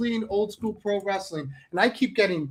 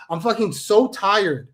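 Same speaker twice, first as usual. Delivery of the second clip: usual speaking voice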